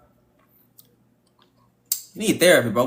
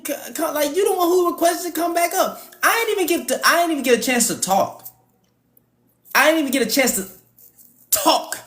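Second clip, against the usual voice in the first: much higher, whiny, near-tearful rant; mocking a crashing-out caller; 7 semitones higher than usual. Host mocking the caller's meltdown in a high whiny voice. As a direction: ham up the upset man as he loses it.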